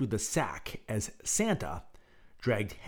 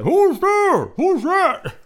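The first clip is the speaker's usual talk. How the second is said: much louder, high panicked yell; 13 dB louder, much slower than usual. A narrator's reading. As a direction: scream it muffled from the sack. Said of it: "Henry yelled".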